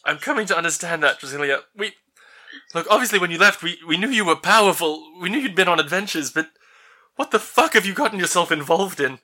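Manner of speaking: worried father. Acted. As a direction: push the pitch up and scold out of worry. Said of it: "And he says".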